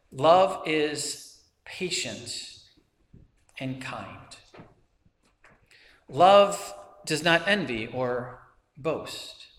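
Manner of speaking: slow, measured verse reading. Real, not acted. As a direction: read scripture slowly, with long pauses between phrases. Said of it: "I'm gonna read it slowly".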